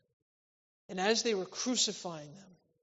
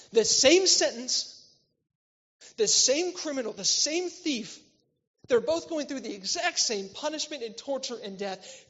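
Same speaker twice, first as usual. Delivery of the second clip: fervent preaching; 6 semitones higher, 8 dB louder than usual. Real, not acted. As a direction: hammer each "same" home. Preacher driving the point home.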